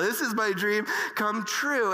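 ordinary delivery

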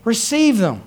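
high, loud, ringing preacher cadence; full-force preaching. Acted. Preacher rousing the congregation about God's provision, full-force preaching. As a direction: preach it out to the whole church.

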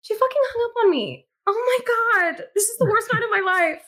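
very high, exasperated lament; acted distraught wail. Player's character after her friend hung up, wailing in distress. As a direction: wail it high as if near despair.

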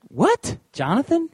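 high, incredulous double take; can't believe what he's hearing; disbelief. Retold or imitated. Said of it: "And I was like".

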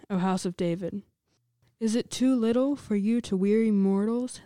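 ordinary talk, nothing marked

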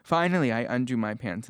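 ordinary talk

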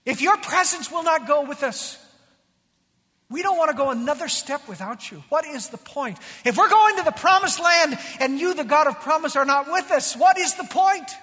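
rising, fervent acted intercession; impassioned plea. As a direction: build from firm appeal to loud, high demand. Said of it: "Moses intercedes".

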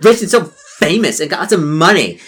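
put-on spoiled girl showing off; bratty rich girl. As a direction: boast wildly about being rich. Mean girl boasting.

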